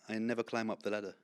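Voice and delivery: soft, understated British delivery; quiet British accent, no r at the end. Shown as a demonstration. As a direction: say it in plain British. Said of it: "Now say it in British".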